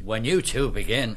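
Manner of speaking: loud, high, abrupt retort. A narrator's reading. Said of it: "McCoy said abruptly".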